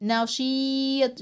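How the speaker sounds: نشيط pulled out like slime; long ii in نشيط. Shown as a demonstration. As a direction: stretch the ii of نشيط. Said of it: "بيطول صوته ازاي".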